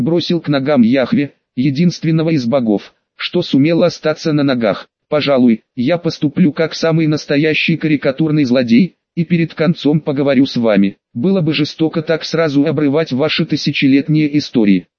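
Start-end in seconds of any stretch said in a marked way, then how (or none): none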